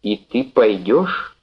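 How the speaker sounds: very slow, drawn-out question; slow, stunned asking; slowly. Acted. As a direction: let each word hang, slowly. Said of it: "медленно проговорила она".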